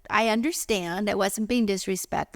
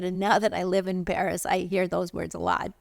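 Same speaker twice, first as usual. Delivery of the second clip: ordinary talk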